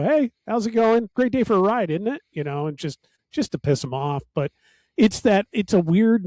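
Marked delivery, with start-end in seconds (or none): 0.0-2.2 mock friendly greeting. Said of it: "you just go".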